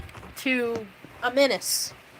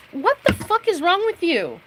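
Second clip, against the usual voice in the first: exasperated outburst; 7 semitones higher than usual. Real, not acted. Ranting at the ex-friend.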